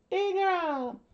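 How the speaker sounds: very high-pitched princess cry; screaming princess. A narrator's reading. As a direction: shriek the name as a princess. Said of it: "the princess screamed out".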